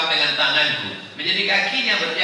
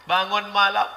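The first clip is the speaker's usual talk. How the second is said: normal talking voice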